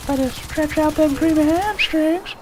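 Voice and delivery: put-on high, cranky old-timer voice; squeaky old codger calling out; old prospector. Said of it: "I know that's a terrible impression".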